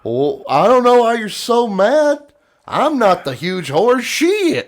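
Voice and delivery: high, loud, slow faux-innocent whine; slow and smug, rising on "She is"; mock-innocent drunk. Drunk friend pushing the stranger too far, high slow taunt. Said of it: "You can kinda guess what happened".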